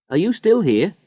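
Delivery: a hint of a cheerful young mother; lightly voiced friendly woman. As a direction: greet him like a kind passer-by. Woman with baby questions Harry.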